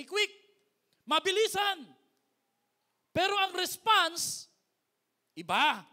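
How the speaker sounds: loud, high, driving sermon lines; full-force preaching. Acted. Pastor drives the point home to the congregation, loud preaching. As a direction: hammer each phrase home. Rapid-fire preaching on reactions.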